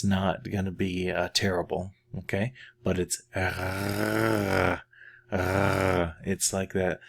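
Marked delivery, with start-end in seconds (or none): 2.8-6.2 r drawn out slowly as a vibrating French R in the back of the throat; French uvular R. Shown as a demonstration. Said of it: "that little thing that hangs down".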